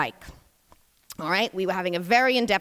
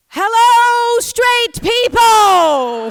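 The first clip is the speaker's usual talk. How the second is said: high, loud, stretched-out cheery yell; child shouting greeting; 10 semitones higher, 7 dB louder, much slower than usual. Retold or imitated. Son greeting a passing couple, loud high cheerful shout. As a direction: call out the window to strangers happily.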